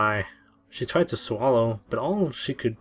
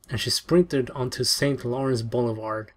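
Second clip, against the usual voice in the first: ordinary delivery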